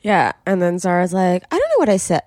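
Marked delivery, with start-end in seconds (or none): 1.5-2.2 high, mock-innocent squeak. Friend mocks Zara playing dumb, high innocent squeak. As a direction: play dumb in a high little voice. Mocking Zara's fake innocence.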